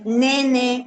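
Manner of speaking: normal talking voice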